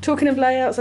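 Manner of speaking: ordinary talk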